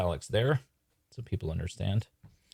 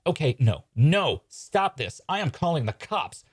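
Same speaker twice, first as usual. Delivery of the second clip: raised, panicky protest; alarmed objection; 8 semitones higher, 5 dB louder, slower than usual. Acted. Parent threatening to call cops on magicians, alarmed. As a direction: put your foot down, pitched up in alarm.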